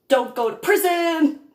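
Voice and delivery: usual speaking voice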